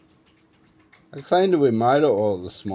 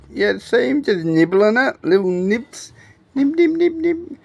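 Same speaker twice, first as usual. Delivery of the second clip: high voice; 11 semitones higher, 4 dB louder than usual. Pretend. High mock bird nibbling.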